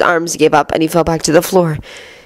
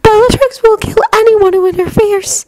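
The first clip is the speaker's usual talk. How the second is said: put-on girl voice. A narrator's reading. Luna voice.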